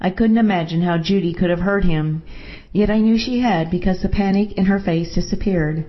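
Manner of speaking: usual speaking voice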